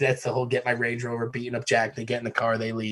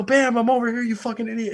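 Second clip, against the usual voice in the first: calling out; 9 semitones higher than usual. Retold or imitated. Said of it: "And I just go".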